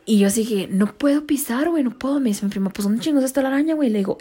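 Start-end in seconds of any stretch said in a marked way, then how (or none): 0.7-2.2 scared. Retold or imitated. Refusing to step near spider. 2.7-3.9 exasperated. Retold or imitated. Cousin exasperated.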